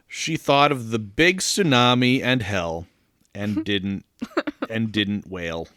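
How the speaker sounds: plain reading voice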